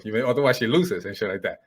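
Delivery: ordinary talk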